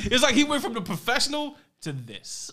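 high pitched laughing delivery. Real, not acted. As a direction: laugh the words out. Laughing about actor's career.

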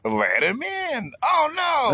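very high, stretched-out mock cry; hammed-up bird voice. Birds at the coop door, hammed-up high cry. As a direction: squeal it as a cartoon bird, playfully.

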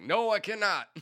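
loud, high, prim refusal; mock refusal. Answering the dead stranger, loud and mock-formal. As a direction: declare it like a formal no.

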